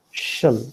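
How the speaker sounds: "shall" said short with a schwa; weak form of shall. Shown as a demonstration. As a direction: reduce "shall" to shuhl. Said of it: "the weak form is".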